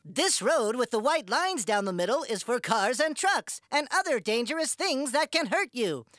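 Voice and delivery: calm explaining. Acted. Explains road safety to kids.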